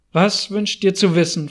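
ordinary delivery, nothing marked

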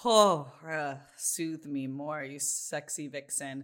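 low, slow, playful swoon; mock seduction. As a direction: purr it slowly, hamming up the seduction. Swooning over the friend's accent.

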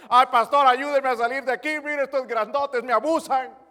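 high, very loud whimpering plea; whining prisoner. Retold or imitated. Prisoner begging the pastor for help, high whining. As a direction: beg like a scared little chick.

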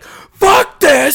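high, loud exasperated outburst of "Fuck this!"; frustrated yell. Retold or imitated. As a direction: blow up at the stuck boxes. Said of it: "And I'm like".

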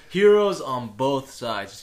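slow, loud, grand title reveal; dramatic announcement. Acted. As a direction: announce it like a movie trailer.

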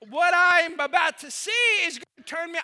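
ordinary delivery

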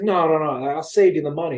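acted-out eager persuading; eager insistence. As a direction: cut in and insist. Pastor insistently offers a lift.

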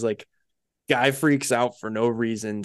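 normal talking voice

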